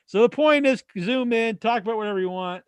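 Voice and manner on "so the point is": usual speaking voice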